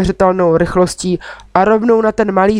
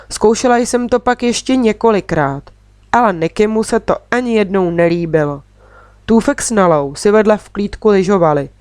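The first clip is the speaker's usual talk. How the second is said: ordinary delivery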